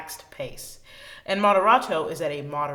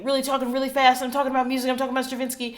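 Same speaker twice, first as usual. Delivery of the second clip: higher, faster and faster, swept up in excitement; speeding up excitedly; 7 semitones higher, 3 dB louder, much faster than usual. Shown as a demonstration. Teacher speeding up to show accelerando, excited rush. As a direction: let the words run away as you get excited about music.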